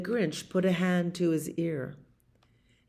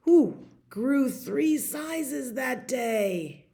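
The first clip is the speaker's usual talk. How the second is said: drawn out slowly, higher, in awe; slow wonder; 6 semitones higher, much slower than usual. A narrator's reading. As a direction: stretch it out with wonder. Climax of story to child.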